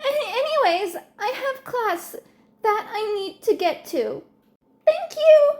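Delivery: put-on a shy kid thanking his idol; shy grateful boy. Boy hurries off politely.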